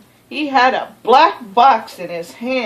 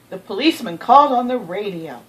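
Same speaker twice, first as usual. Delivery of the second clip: ordinary delivery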